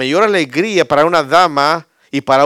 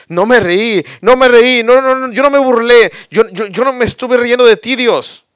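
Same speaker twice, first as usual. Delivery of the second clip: much higher and louder, rushed panicked protest; frantic denial; 10 semitones higher, 7 dB louder than usual. Acted. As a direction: plead your innocence in a panic. Sara's frantic denial to God.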